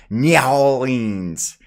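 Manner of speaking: "Nyowlings" stretched slow and loud, NYAH-leens; really funny pronunciation. Shown as a demonstration. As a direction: exaggerate "Nyowlings" with a long drawn first syllable. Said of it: "I just pronounce it really funny".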